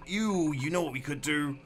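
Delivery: rushed, eager brainwave; suddenly lit up with an idea; excitable. Real, not acted. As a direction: blurt out the idea in a burst. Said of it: "a little bit excitable".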